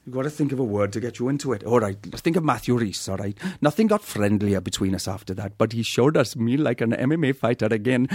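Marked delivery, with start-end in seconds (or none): 3.6-8.1 Welsh accent sliding into an Indian accent, laughing; a Welsh reading that drifts into Indian, high and laughing; Welsh accent attempt. Shown as a demonstration. A Welsh accent, failing, reading from a book. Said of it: "my impression badly".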